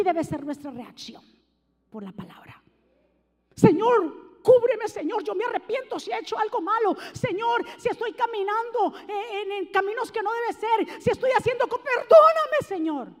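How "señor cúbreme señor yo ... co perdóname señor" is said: higher pleading, rising to a cry on perdóname; pleading prayer. Retold or imitated. Voicing a believer's prayer to the Lord, pleading. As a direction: beg the Lord for forgiveness.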